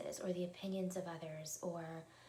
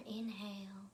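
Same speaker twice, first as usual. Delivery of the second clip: calm breathing guide with long pauses; guided meditation; 3 dB quieter than usual. Acted. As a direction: guide them gently through each breath, unhurried.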